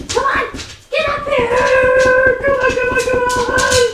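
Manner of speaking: very high, eager coaxing; squeaky call to get the dog to jump up; high pet voice. Real, not acted. Coaxing the dog up onto the couch, very high voice.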